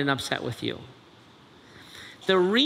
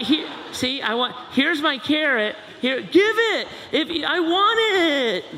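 put-on demanding kid; 10 semitones higher than usual. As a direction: demand it like a greedy child.

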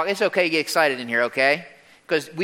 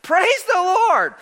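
high, drawn-out shouts of praise; loud, slow, soaring acclamation; jubilant shout; 5 dB louder, slower than usual. Acted. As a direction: shout it out for a whole multitude. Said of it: "again they shouted".

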